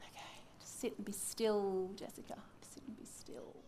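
hushed whisper to herself; breathy self-soothing whisper. Acted. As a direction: whisper it, trying to stay calm. Calming herself, acted prayer scene.

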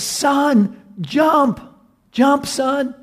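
high-pitched, desperate command; acted frantic father's shout; urgent father. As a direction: call up to a trapped son. Father urging son from fire.

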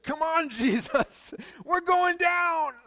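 high, slow shout in another voice, half laughing; panicked shout. Acted. Disciples to Jesus as the boat sinks, hammed-up high shout.

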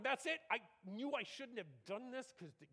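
Jonah throwing up his hands; exasperated Jonah. Acted. Preacher voicing Jonah griping at God, exasperated.

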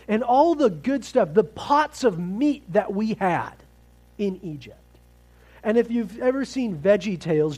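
loud, high fervent preaching. Acted. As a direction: preach it with rising fire. Preaching builds to full force.

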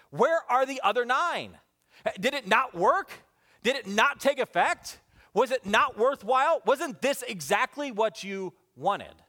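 high, insistent string of questions; dumbfounded questioning. Acted. Jesus questioning why nine never returned, dumbfounded. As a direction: press each question in mounting disbelief. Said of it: "Jesus dumbfounded".

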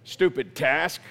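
low, grudging grumble; bad attitude. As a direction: drag it out like a sulky complaint. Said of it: "you have this bad attitude".

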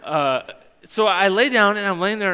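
usual speaking voice

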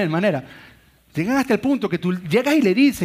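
usual speaking voice

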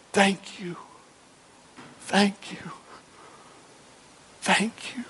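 hushed, whispered prayer of gratitude; whispered thanks. Acted. Worshipper giving thanks to God in praise, whispered. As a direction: whisper it with reverent pauses.

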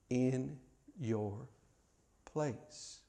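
trailing off almost to nothing; hushed echo. Acted. Preacher echoing God's words, dying away softly. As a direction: let the words fade to a breath.

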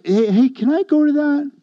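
eager asking. Retold or imitated. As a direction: jump in like an excited kid begging to come. Eager self asking to join.

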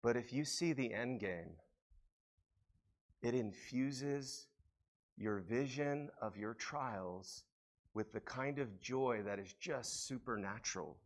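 quiet awe. Real, not acted. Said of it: "quiet intimate preaching".

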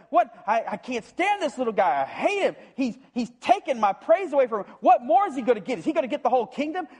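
high, indignant whine; jealous Saul. Acted. Saul hearing the women sing of David, jealous whine. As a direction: spit it out, stung by the praise.

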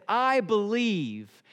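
slow, drawn-out acted plea; crying out. Father of the child cries out to Jesus, pleading. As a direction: cry it out word by word, very slowly. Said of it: "cried out and he said".

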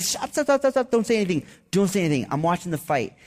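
retold yell at coworkers to stop spoilers; frantic shouting to stop. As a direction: yell stop before the result gets spoiled. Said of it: "I'm like".

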